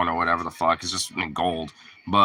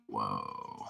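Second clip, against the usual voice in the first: awed whoa; 16 dB quieter than usual. Retold or imitated. Stunned at the concert, still tripping.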